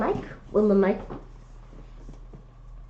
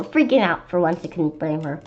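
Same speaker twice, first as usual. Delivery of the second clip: ordinary delivery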